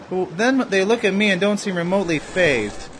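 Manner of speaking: ordinary talk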